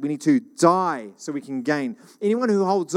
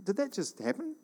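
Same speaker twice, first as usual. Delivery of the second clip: softer, quietly stunned question; a hushed did-that-just-happen; mild double take; 3 semitones higher, 8 dB quieter than usual. Retold or imitated. Crowd member caught off guard, softly stunned. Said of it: "you probably almost double take".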